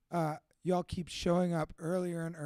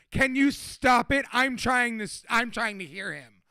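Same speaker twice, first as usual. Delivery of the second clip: mock cranky lady; 4 semitones higher, 7 dB louder than usual. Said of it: "She literally looked at him and goes".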